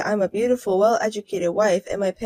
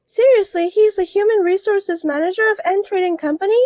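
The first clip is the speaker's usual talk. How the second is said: higher, louder disbelieving woman; higher voiced woman in disbelief at the news; astonished rival wife; 11 semitones higher, 4 dB louder than usual. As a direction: voice her higher, shocked she married him.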